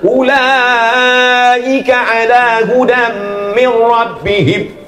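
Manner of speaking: slow "Ulaika" with the longest vowel held; slow drawn-out recitation; longer vowel demo. Shown as a demonstration. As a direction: hold the vowel even longer. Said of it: "Ada panjangnya lebih lama".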